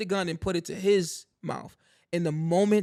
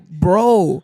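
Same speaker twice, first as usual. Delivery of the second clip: loud, drawn-out reenacted "bro"; stunned exclamation; 10 dB louder than usual. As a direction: blurt it out loud in disbelief. Retells own stunned reaction.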